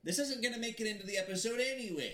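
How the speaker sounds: silly voice. Acted. Player dodging blame for a taboo word, silly voice. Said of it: "He said it in a silly voice".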